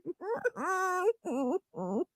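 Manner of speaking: high, breathless laughing close to crying; hysterical laugh. Real, not acted. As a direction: laugh until it almost becomes crying. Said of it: "I'm hysterical laughing".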